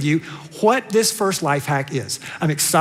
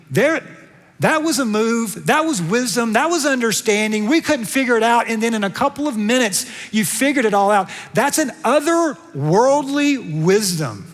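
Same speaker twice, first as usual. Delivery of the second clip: ordinary talk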